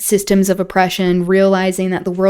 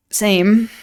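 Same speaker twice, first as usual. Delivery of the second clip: ordinary delivery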